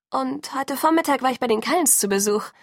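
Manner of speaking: high, would-be cheerful confession; forced cheerfulness. A narrator's reading. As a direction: sound breezy despite a queasy stomach. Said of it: "mit flauem Magen und bemüht fröhlicher Stimme". (bemüht fröhliche Stimme)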